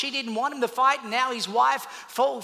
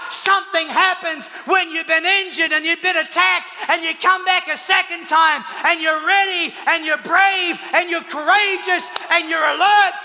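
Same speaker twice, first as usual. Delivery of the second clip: normal talking voice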